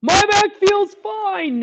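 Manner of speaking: put-on a proud old Santa insisting; hearty Santa. Santa brushes off gout.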